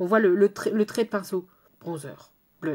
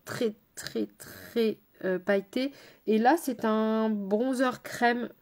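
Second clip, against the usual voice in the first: ordinary delivery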